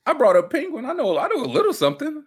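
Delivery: squeaky and proud; high-pitched brag. Real, not acted. Bragging to his friend about Gotham, high and proud. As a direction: brag like a cartoon. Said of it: "joking brag".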